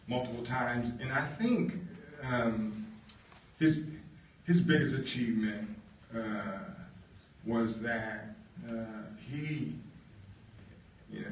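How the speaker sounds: ordinary talk, nothing marked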